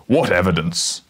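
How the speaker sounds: menacing. A narrator's reading. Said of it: "menacing grin".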